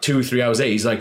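normal talking voice

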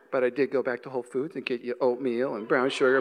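low, soft, matter-of-fact reveal; quietly spring the trap; calm wife reply. Retold or imitated. Wife calmly revealing she bought the oatmeal, low soft reply. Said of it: "She's like".

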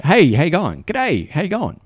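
a quick chirpy hello while walking past; breezy corridor greeting. Retold or imitated.

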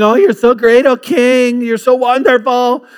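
high, loud, gushing flattery of a king; fawning kiss-up. Acted. Bureaucrat buttering up the king, fawning loudly. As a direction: lay on the sucking-up, pitched high. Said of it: "they're saying".

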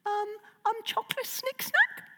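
very high, hopeful dragon squeak; hopeful little dragon. Acted. Toothless hopefully guessing about the poo, high and pleading. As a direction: plead sweetly in a tiny dragon voice.

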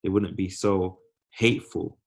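normal talking voice

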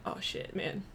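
ordinary talk, nothing marked

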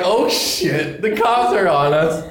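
high-pitched mock panic; retold alarm. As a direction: act out being caught by the cops. Retold panic as cops arrive.

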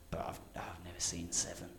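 whispered, barely voiced; hushed confession. Rapper confessing to his best friend in a whisper. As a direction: breathe it out like a shameful secret.

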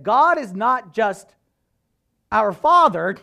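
loud, slow mock-sermon proclamation; emphatic preaching. Preacher re-enacting his mistaken sermon, grandly emphatic. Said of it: "giving them the business".

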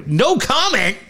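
high-pitched, disbelieving question; incredulous. Real, not acted. Host repeating the team's reply to his co-host, incredulous. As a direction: throw the reply back as a high, stunned question.

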